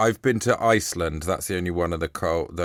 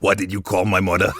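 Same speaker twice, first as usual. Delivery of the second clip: affronted mock Brooklyn accent. Acting offended over the Sea Mother, Brooklyn accent. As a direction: challenge them in a put-on Brooklyn accent. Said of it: "like, a Brooklyn accent".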